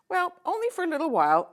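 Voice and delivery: high, quick and loud; merchant king. Acted. Merchant king answering the girl, lightly voiced.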